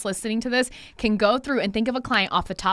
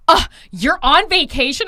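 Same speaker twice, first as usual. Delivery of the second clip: mock indignant yell, higher and louder; imitated yelling client; 6 semitones higher, 8 dB louder than usual. Client yelling at stylist on vacation, mock outraged yell. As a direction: yell it like an outraged, entitled caller. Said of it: "Literally yelling at me on vacation".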